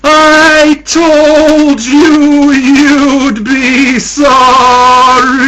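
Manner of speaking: loud, high, drawn-out ghostly wail; tearful wail. A narrator's reading. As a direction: wail it out, stretching every word. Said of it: "wailing tearfully".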